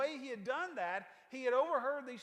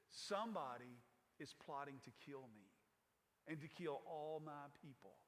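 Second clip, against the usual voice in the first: hushed confession; 8 semitones lower, 11 dB quieter than usual. Retold or imitated. Esther reveals plot.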